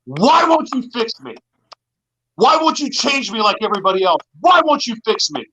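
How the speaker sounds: high, pleading retold yell; screaming at God. Retelling screaming at God in his cell, high pleading yell. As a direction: cry out at God, demanding answers. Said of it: "I just started screaming at God".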